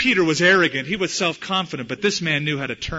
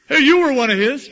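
much higher and louder, pointing the finger; accusing servant girl; 10 semitones higher, 9 dB louder than usual. Acted. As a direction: call him out like a sharp-eyed servant girl.

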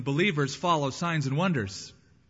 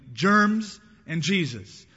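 put-on complaining kid; 5 semitones higher, 5 dB louder than usual. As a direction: imitate a fed-up little kid, stretching the words loudly. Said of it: "ran away complaining".

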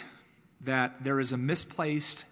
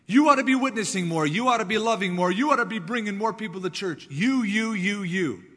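higher and louder, hammering every ought; mock guilt-trip preacher; 6 semitones higher, 6 dB louder than usual. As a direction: nag them like a scolding preacher. Mocking guilt-trip preaching at congregation.

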